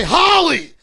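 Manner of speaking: high loud yell of the name; mock shout. Comedian mocking Holly's yelling, loud mock shout. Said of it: "I don't say".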